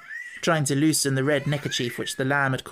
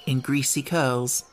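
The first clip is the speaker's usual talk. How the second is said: ordinary delivery, nothing marked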